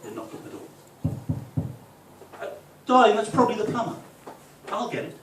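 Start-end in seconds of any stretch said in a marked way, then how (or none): 2.4-5.2 high, raised call through the house; lilting, affected domestic voice; genteel home voice. Acted. Man telling his darling the plumber has arrived, affectedly genteel. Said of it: "Fucking two different people".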